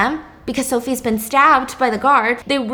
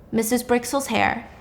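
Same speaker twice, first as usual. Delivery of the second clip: usual speaking voice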